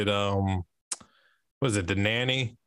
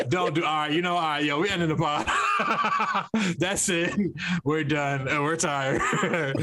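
high-pitched talk through laughter; laughing; 7 semitones higher than usual. Real, not acted. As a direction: call it off while cracking up. Cracking up, ending the podcast.

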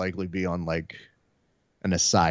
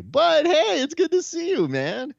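high, loud happy hello; mock excited greeting; 5 dB louder than usual. [name] greeting his friend, high excited mock hello. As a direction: beam a big hello at him.

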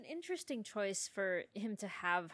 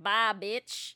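sassy; 8 dB louder than usual. Retold or imitated. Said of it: "She's just like".

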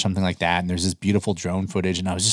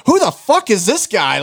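high, loud, slow incredulity; retold disbelief; 12 semitones higher, 5 dB louder than usual. Viewer reacting to a loud YouTuber, mock shock. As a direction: stretch it out, taken aback.

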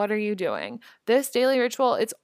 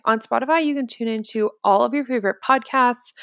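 upbeat sponsor read; 8 dB louder than usual. Acted. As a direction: sell it like a radio ad.

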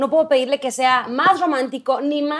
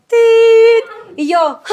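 shocked cry; 9 semitones higher, 9 dB louder, much slower than usual. Retold or imitated. Hears her ex married.

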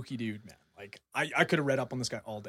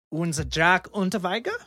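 high, loud mock-German accent; best German accent; 5 semitones higher, 6 dB louder than usual. Shown as a demonstration. Podcast host butchering a killer's name for the co-hosts, hammed-up German accent. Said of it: "do it in my best accent".

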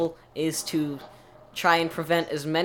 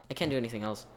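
ordinary delivery

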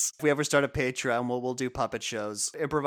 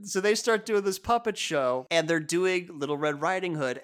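normal talking voice

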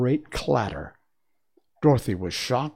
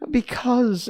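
much higher small voice; high princess voice; 12 semitones higher, 3 dB louder than usual. Acted. Princess explaining to Dorothy, small high voice. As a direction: voice the tiny china princess.

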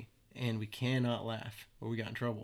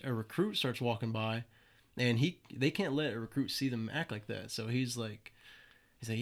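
ordinary talk

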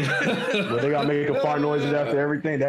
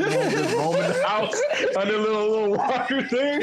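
high, laughing through the picture; giggling at the image of roaming babies; 11 semitones higher than usual. Real, not acted. Picturing twins in walkers.

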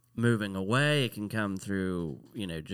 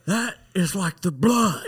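slow, high shouted lecture, imitating a grandpa; mock chewing-out; 9 semitones higher, 7 dB louder than usual. Grandpa lecturing a kid about oil, slow shouted imitation. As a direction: shout it word by word like an outraged grandpa. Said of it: "chewed you out for oil".

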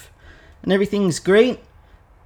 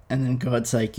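ordinary delivery

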